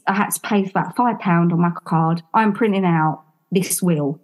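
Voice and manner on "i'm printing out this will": plain reading voice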